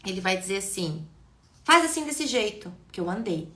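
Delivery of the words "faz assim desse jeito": put-on loud, high, bossy command to a child; authoritarian father. Shown as a demonstration. Strict father ordering his child around, loud and bossy. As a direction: bark the order like a domineering parent. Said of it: "Vejam a impostação de voz, a autoridade".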